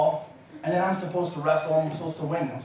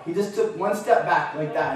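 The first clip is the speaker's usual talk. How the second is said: ordinary talk, nothing marked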